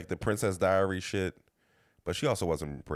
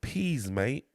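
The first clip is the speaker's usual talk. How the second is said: higher-pitched put-on British voice; British accent; 7 semitones higher than usual. Co-host imagining himself acting over there, mock British accent. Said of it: "I'm about to do a British accent".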